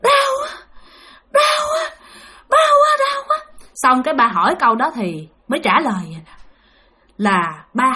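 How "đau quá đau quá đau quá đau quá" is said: very high-pitched wailing in pain; mock spirit crying out that it hurts. Medium's possessed lament, retold.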